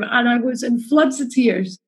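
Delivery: ordinary talk, nothing marked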